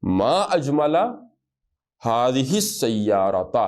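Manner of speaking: ھذہ السیارۃ very slowly, ھذہ leaned on; slow model reading. Shown as a demonstration. Teacher modelling the exclamation for students, very slow. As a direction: stretch each syllable. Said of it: "آگے آپ نے کہنا ہے".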